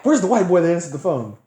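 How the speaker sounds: loud, high, demanding retold shout; retold angry holler. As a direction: bellow it like a man storming in. Retells angry man storming in.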